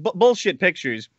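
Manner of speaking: normal talking voice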